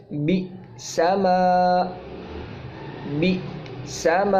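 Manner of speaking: بئس ما drawn out, hamza clipped with a catch; hamza demo repeated. Shown as a demonstration. Teacher repeating the word for students, slow. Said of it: "جھٹکا دے کے پڑھا جاتا ہے".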